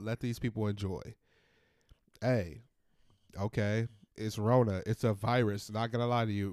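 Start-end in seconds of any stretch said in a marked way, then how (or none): none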